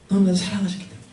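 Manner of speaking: soft tender echo. Real, not acted. Preacher softly echoing 너무나도 to the congregation, tender. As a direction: breathe the words out tenderly. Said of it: "바로 그들을 너무나도 사랑하셨기".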